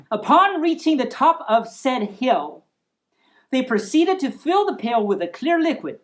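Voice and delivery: ordinary delivery, nothing marked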